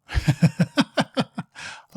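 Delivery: loud, high staccato laugh; bursting laugh. Real, not acted. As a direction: burst out laughing in a long run. Laughs at the failed test.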